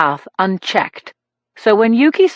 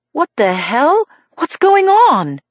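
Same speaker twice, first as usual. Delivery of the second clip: alarmed; 7 semitones higher, slower than usual. A narrator's reading. Waking confused in hospital.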